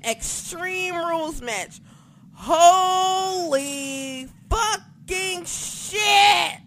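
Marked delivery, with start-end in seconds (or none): 2.4-6.6 loud, high, slow drawn-out exclamation; loud long thrilled cry; excited outburst. Real, not acted. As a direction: stretch it out in sheer hype. Hyping the big match.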